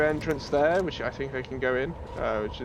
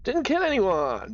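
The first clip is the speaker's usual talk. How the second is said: triumphant; 9 semitones higher, 4 dB louder, slower than usual. Real, not acted. Celebrating mission result.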